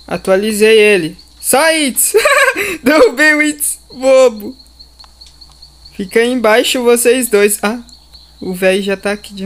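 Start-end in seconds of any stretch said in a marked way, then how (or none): 1.4-4.6 laughing. Real, not acted. Pushes friend off, laughing.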